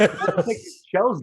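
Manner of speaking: laughing. Real, not acted. Laughing after Yoda joke.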